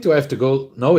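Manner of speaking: normal talking voice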